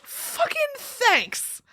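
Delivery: high, slow, drawn-out sarcastic thanks. Real, not acted. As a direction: stretch it out in mock gratitude. Sarcastic reaction to backhanded compliment.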